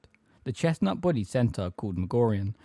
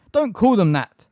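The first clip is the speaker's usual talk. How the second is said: loud, high urgent scolding; furious; 10 semitones higher, 9 dB louder than usual. A narrator's reading. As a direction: cut in furiously at raised pitch. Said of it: "Hermione said furiously".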